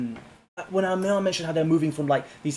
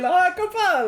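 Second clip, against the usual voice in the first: Hakkapale sung out much higher, Italian-style; mock Italian-sounding; 5 dB louder, slower than usual. Co-host mimicking the battle cry as if Italian, much higher. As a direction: tease the word like an Italian. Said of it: "Sounds like Italian".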